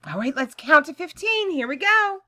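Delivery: slow, loud count-along; counting with kids. Acted. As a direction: count out for the class. Counting aloud with class.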